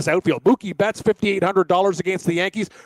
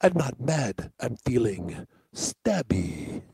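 low, soft, slow mob boss menace; calm threat like a mob boss; Fat Tony; 8 semitones lower, 7 dB quieter, much slower than usual. Acted. Fat Tony coolly telling a friend he's feeling stabby. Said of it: "we had Fat Tony on".

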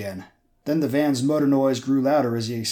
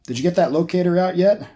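urgent shout; 6 semitones higher, 6 dB louder than usual. Acted. Urgent question in car chase.

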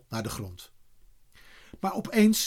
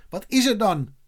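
raised, higher-pitched call; calling from afar; 7 semitones higher, 4 dB louder than usual. A narrator's reading. Eddy asks his waiting friends, calling from afar. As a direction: call the question over to them. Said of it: "riep hij al van verre".

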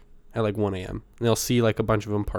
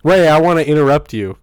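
much louder, higher mock cut-in; loud, raised-pitch playful interjection; loud interruption; 4 semitones higher, 11 dB louder than usual. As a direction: burst in over their story, playfully loud. Playfully cutting into the other's story.

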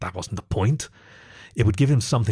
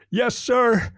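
high, moaning cry of obedience; moaned; 13 semitones higher than usual. A narrator's reading. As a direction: cry it out much higher. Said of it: "he moaned".

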